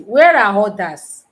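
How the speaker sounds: loud call to the silent class. Real, not acted. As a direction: call out for the missing students.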